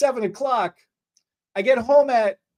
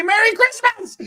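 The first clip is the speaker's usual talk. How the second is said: high, loud cheerful holiday greeting; cheery greeting; 12 semitones higher, 3 dB louder than usual. Retold or imitated. Stylist greeting clients, high and cheerful. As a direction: belt it out like a jolly greeter. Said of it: "all I know is to say".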